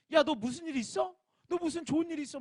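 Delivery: a friend noticing your joy, lightly voiced; curious friend. Friends asking what's good.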